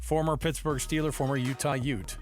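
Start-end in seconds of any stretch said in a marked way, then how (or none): none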